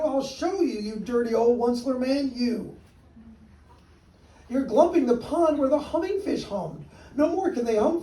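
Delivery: high, loud scolding creature voice; the Lorax berating the Onceler; angry Lorax. Acted. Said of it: "snapped the Lorax".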